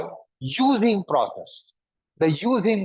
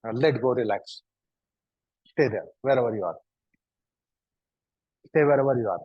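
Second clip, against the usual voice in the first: gentle calming cue; 4 semitones lower, faster than usual. Acted. Instructor calms class into stillness.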